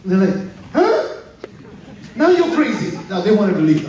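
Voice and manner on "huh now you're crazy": very high-pitched incredulous reaction; squeaky-high acted surprise; mock astonishment. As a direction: imitate a listener thrown by disbelief. Acts astonished listeners' reaction.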